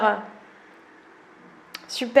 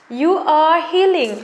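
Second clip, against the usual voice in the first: slow, lingering English phrase; slow card read; 3 semitones higher, slower than usual. Shown as a demonstration. Vlogger reads a card aloud to viewers, slowly. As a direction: read the card like a gentle affirmation. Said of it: "reading card text".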